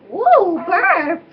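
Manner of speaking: playful cheer. Real, not acted. Cheers her baby's burp.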